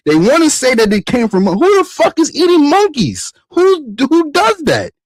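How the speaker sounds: fed-up high-pitched outrage; outraged yelling. Real, not acted. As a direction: rant at full pitch, disgusted and baffled. Outraged rant about rats and covid.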